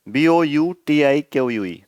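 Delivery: "बी ओ यू टी आई Q यू ई" spelled slowly, letter by letter; spelling out letters. Shown as a demonstration. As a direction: dictate each letter of "बी ओ यू टी आई Q यू ई" slowly and clearly. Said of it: "ये spelling सही रहेगी इसकी".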